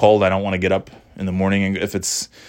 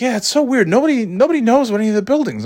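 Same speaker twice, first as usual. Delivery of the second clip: much higher, chatty agreeing voice; high voiced stranger; 13 semitones higher, faster than usual. Guy replies to the narrator in a much higher voice.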